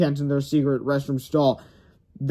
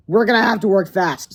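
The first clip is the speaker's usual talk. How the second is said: put-on higher, louder hurried boy; urgent kid; 5 semitones higher, 6 dB louder than usual. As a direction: push your friend to hurry. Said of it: "said Harold".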